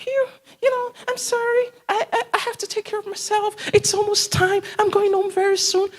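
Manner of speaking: high-pitched mock employee voice; clock-watching employee. Worker excusing herself at closing time, high mimicking voice. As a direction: put on a high, hesitant excuse-making voice. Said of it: "once it's four thirty".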